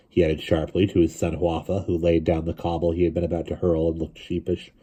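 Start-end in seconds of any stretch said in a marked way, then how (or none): none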